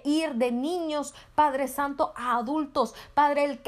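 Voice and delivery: ordinary talk, nothing marked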